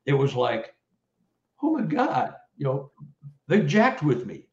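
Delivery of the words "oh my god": high shocked exclaim. Retold or imitated. Reliving the shock.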